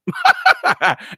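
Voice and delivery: sudden high burst of laughter. Real, not acted. Laughing at absurd chat.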